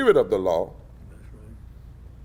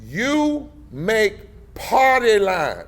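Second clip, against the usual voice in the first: slow, high, drawn-out imitated order; quoted instruction; 7 semitones higher, much slower than usual. Party director's letter to two Republicans, slow emphatic order. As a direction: stretch each word out emphatically. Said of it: "sent a letter saying to those".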